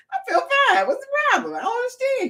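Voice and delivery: high, bewildered mock protest; baffled protest. Told about the doctor's call, a high bewildered protest. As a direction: squeak it out, baffled. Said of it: "I'm like".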